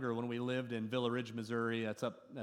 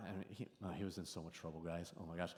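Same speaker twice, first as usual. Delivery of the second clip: much softer, low confiding; telling the congregation how much trouble he was in; hushed aside; 7 semitones lower, 12 dB quieter, faster than usual. Real, not acted. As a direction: lean in and drop the voice.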